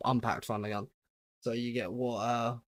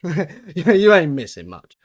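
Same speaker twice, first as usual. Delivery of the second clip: laughing; 4 semitones higher, 11 dB louder, slower than usual. Real, not acted. Laughing repeat of own joke.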